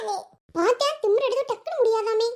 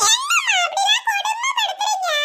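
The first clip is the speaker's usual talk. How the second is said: high, slow laughing cry of protest; laughing protest shout; 11 semitones higher than usual. Acted. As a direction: wail it out half laughing, much higher. Girl protests loudly, laughing. (உரத்த சிரிப்புடன் எதிர்ப்பு)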